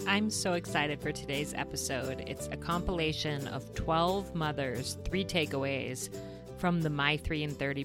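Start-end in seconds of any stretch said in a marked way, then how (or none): none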